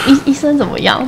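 high-pitched anxious question; retold nervousness. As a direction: re-enact an anxious patient's stammered question. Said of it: "然后我就很紧张".

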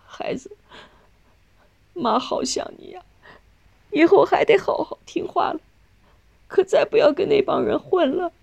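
starts hushed and breathless, then rises high and tearful; crying. A narrator's reading. Mother to her convicted son, pleading while crying. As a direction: plead through tears, voice breaking. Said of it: "那琴哭着说".

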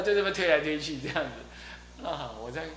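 normal talking voice

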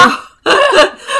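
high, loud laugh bursting out. Real, not acted. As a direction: laugh at your own bad behaviour. Laughing at her own story.

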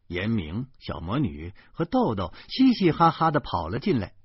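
ordinary delivery

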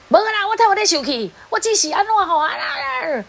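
high, sharp, insisting it's not anger; mock angry denial. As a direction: act out the partner snapping that he isn't angry. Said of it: "格格叫安尼吼".

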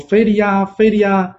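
high, piercing yell of a falsely accused victim; loud cry for help. A narrator's reading. Pipa spirit screams at the crowd to frame the old man, shrill yell. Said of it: "然后就大叫说".

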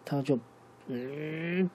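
usual speaking voice

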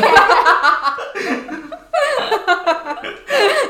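long, high real laughter. Real, not acted. Laughing after her co-host says don't toy with grandpa. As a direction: keep laughing.

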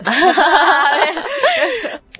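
a real burst of high laughter; hearty laugh. Real, not acted. Co-host laughs at calling Valjean ruthless, hearty laugh. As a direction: laugh out loud, amused.

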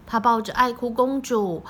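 usual speaking voice

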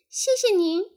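high, loud little tadpole thanks. A narrator's reading. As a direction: pipe up politely as a baby tadpole. (小蝌蚪高声道谢)

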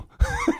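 high squealing laugh. Real, not acted. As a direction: burst out laughing. Laughs at the awkward moment. (高声大笑)

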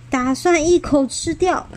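ordinary delivery, nothing marked

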